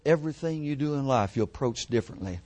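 usual speaking voice

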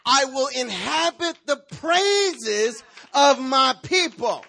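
loud, slow preacher's proclamation; fiery sermon voice; preaching. Acted. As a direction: proclaim it over the church, drawn out. Preaching at full force.

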